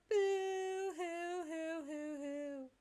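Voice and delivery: loud, slow hammed-up boo-hoo wail; mock wailing. Jade Sprite bursts into tears remembering Prospect, loud mock wailing. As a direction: bawl it out like an overgrown cartoon baby. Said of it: "I'm no longer gonna do Jade Sprite crying".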